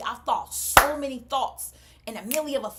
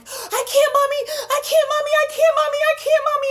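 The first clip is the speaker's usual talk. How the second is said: high, loud imitation of a child's wail; mimicked frantic scream; panicking child; 11 dB louder than usual. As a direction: shriek it helplessly like a trapped child. Daughter panicking at stuck seatbelt.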